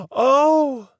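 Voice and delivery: the penny drops, scandalized; shocked gasp. A narrator's reading. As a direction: gasp then a loud high "Oh!". Mary Mabel's shocked realization.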